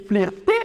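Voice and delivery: "flirter" with an ee vowel, fleer-tay; mispronounced flirter. Shown as a demonstration. Teacher imagines an anglophone saying it, "flirter" as fleer-tay. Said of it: "si un anglophone, par exemple, dit".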